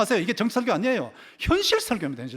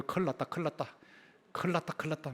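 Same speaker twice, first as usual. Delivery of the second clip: put-on muttered Gyeongsang-accented alarm, softer; Gyeongsang dialect; 6 dB quieter than usual. Preacher demonstrating how locals say it's all over, Gyeongsang dialect. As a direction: slip into light Gyeongsang dialect. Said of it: "경상도 사투리".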